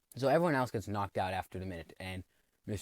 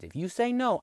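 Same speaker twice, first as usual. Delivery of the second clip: much higher, louder threat; mock threat shout; 13 semitones higher, 6 dB louder than usual. Boss threatening the boys, yelled high. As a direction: yell the threat like a cartoon villain. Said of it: "He's like".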